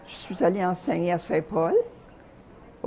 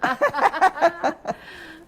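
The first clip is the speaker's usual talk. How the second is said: amused laugh; 12 semitones higher, 5 dB louder than usual. Real, not acted. Laughing about brothers, sometimes tough.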